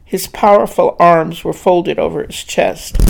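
plain reading voice

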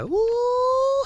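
long, high-pitched mock "ooh"; shaking demon. Mocking the demon shaking in its boots, high squeal. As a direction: hold a high hooting squeal. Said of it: "That demon shaking up in they boots".

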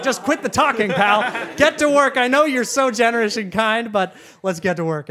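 loud, high, impatient outburst easing off at the end; mock-exasperated pleading; exasperated urging. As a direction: cut off a rambler, loudly. Acted impatience with the talker.

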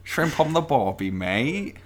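a cartoon Aussie tourist-ad voice; mock Australian. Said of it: "Let's not do accents".

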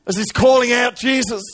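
high-pitched dramatic sermon telling; impassioned preaching. Acted. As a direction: preach the story at full pitch. Preaches at full force.

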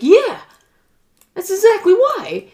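high, loud emphatic agreement; emphatic yes. Retold or imitated. Telling Roberto why the couple divorced, high and emphatic. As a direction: confirm it hard and high.

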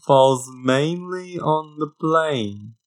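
drawn-out, singsong British vowels; British accent. Shown as a demonstration. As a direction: demonstrate an elocution-lesson British accent. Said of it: "supposed to be a British accent".

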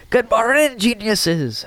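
lightly put-on Scottish teacher greeting, high on morning; a weak stab at a Scottish brogue; bad Scottish accent. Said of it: "I can't do a good Scottish accent either".

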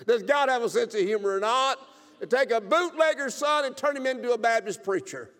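loud, high exultant preaching; preacher marveling loudly at God's humor; joyful preaching. Real, not acted. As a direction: shout it with delight.

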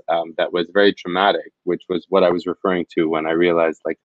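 normal talking voice

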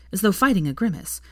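usual speaking voice

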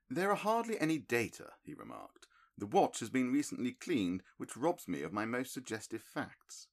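measured, mildly disappointed sleuth; a hint of a cool analytical detective; Holmes, lightly voiced. A narrator's reading.

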